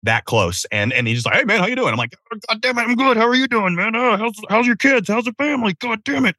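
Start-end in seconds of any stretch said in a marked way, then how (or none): 1.4-1.9 cheery neighbor. Retold or imitated. Neighbor greets him on the walk. 2.3-6.4 high, strained small talk through clenched effort; squeezed high voice of someone desperately holding it; strained politeness. Retold or imitated. Forcing small talk while holding it in.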